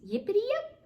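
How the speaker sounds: high, loud yippity yip; gleeful yes. Acted. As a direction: bounce it out joyfully. Otter answers yes gleefully.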